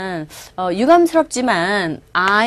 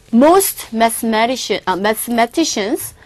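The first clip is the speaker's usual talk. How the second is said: slow text reading; much slower than usual. Shown as a demonstration. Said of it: "teacher reads text".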